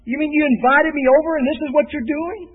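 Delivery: high, loud, fast indignant protest; mock outraged dinner guest; insulted guest. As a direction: sound offended at the meal.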